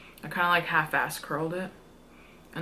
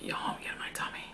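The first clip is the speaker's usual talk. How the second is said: whispering; 9 dB quieter, faster than usual. Retold or imitated.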